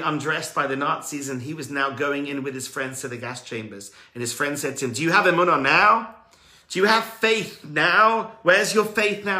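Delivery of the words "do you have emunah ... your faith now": loud, higher jeering challenge; mocking taunt. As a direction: throw the taunt at him, scornful. Mocking friend in gas chamber.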